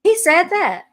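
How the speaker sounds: high, loud gasp of disbelief; can't believe what she just heard; sudden incredulous question; shocked disbelief. Real, not acted. Shocked at the father's quote.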